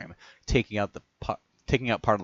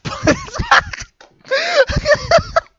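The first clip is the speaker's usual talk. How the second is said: loud laugh; 12 dB louder than usual. Real, not acted. Laughing at own Irish voice.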